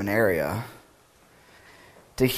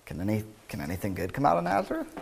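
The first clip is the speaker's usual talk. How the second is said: quick and softer, tumbling through the question; rushed, softer take; 7 dB quieter, much faster than usual. Shown as a demonstration. Pastor tries Nathanael's question again, fast and soft. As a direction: rattle it off fast under the breath. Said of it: "imagine how Nathanael said it".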